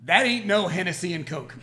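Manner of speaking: high, loud verdict; hammed-up outrage after a taste; imitated indignant woman. Woman rejecting the drink after a big gulp, mock indignant. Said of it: "That is exactly what she said".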